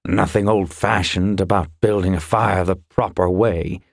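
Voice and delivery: grumbled retort in a character voice; grumbling. A narrator's reading. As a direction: grumble it in defence of old ways. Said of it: "he grumbled".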